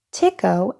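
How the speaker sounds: plain reading voice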